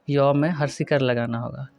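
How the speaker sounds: ordinary talk, nothing marked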